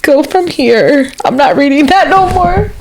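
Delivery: can't go on reading, half crying; real choked-up voice; tearful refusal. Real, not acted. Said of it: "you're gonna make me cry".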